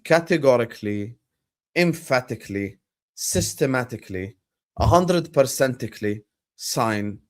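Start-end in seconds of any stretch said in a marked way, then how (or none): none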